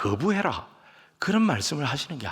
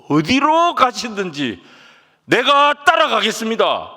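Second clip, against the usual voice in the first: loud, high-pitched eager declaration; bold pledge; 10 semitones higher, 8 dB louder, slower than usual. Acted. Scribe vowing to follow Jesus anywhere, loud bold pledge. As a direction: proclaim it at full voice, stretching the first words.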